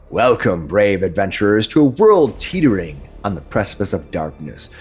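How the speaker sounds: grand fantasy narration over music; epic narration. Acted. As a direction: set the scene like a dark fantasy trailer. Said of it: "Did I oversell it?".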